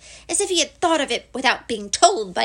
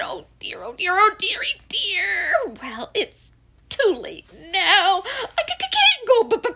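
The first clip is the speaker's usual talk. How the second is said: high, whimpering small creature; high-pitched fretful stammer; distraught stammering Piglet; 8 semitones higher, 5 dB louder than usual. Acted. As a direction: stutter through near-tears, building in volume. Piglet upset over burst balloon.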